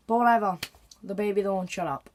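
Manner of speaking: ordinary delivery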